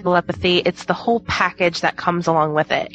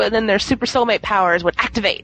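ordinary delivery

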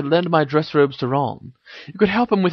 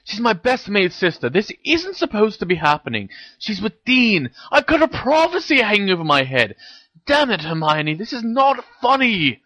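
high, agitated telling-off; angry protest; 11 semitones higher than usual. A narrator's reading. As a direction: insist frantically, voice pitched up in distress. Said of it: "he hissed".

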